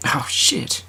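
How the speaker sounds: hushed whisper of mock alarm; whispered aside. Real, not acted. Listener reacts to the wrong-prince twist, whispered. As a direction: breathe it like a scandalized aside.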